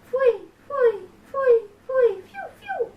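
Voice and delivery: high whistled bird call, falling; cuckoo call. Acted. Narrator imitating the cuckoo's spring call.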